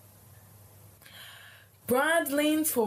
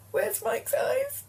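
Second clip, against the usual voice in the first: mock wail; slower than usual. Mock cry in panic.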